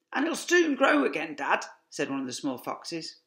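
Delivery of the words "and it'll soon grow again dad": high little fox cub; child fox voice. A narrator's reading. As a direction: voice a hopeful young cub.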